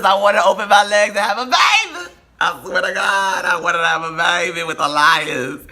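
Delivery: high, loud, gushing declaration; swooning shout. Real, not acted. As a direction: shout it like you're head over heels. Swooning over Dominican men.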